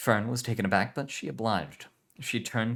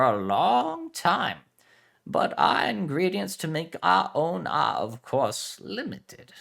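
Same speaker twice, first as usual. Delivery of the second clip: put-on Spanish accent; 8 semitones higher, 6 dB louder, slower than usual. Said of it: "Spanish heritage".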